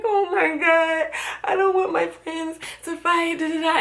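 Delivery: high, whiny mock crying voice; imitating crying. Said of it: "and I start crying".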